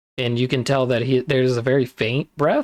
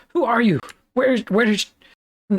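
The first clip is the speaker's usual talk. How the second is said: panicked; 9 semitones higher than usual. Acted. Freed captive panics.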